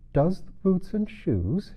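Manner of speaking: high, slow puzzled question; wondering. Acted. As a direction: repeat it, baffled. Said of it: "she repeated in a wondering tone".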